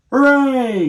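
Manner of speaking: high, loud cheer; shouting. Acted. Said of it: "shouted everyone".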